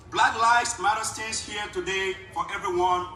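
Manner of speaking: normal talking voice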